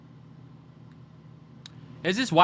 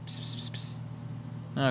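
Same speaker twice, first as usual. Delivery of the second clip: cat call psst; 10 dB quieter, faster than usual. Real, not acted. Calling the cat again.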